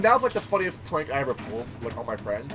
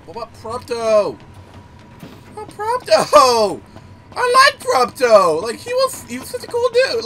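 mock lament; 8 semitones higher, 9 dB louder, slower than usual. Real, not acted. Wailing over losing Prompto.

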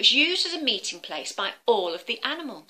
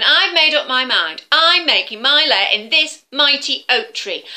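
louder, firm dragon voice; stubborn dragon; 4 semitones higher, 10 dB louder than usual. Acted. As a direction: insist like a bully. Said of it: "said the dragon".

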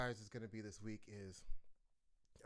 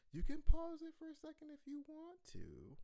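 sing-song high; 7 dB louder than usual. Real, not acted. Teacher tells kids to pause.

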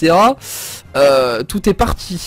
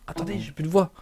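much softer, strained, voice almost gone; 11 dB quieter, faster than usual. Real, not acted. Player complaining to viewers his voice is gone, strained near-silent voice. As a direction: push the words out with barely any voice.

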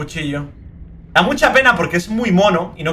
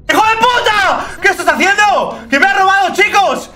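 much higher, louder outraged shouting; real shout at a thieving creature; furious yell; 14 semitones higher, 5 dB louder than usual. Real, not acted. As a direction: scream in disbelief at the thief. Sea monkey steals his gun.

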